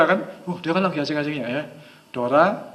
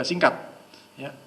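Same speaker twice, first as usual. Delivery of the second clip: ordinary talk, nothing marked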